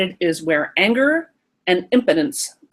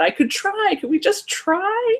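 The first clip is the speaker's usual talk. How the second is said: put-on much higher childlike asking; hopeful little kid; 12 semitones higher, slower than usual. Reading Marcy's chat reply as the eager kid. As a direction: ask hopefully like a small child. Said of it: "Marcy says".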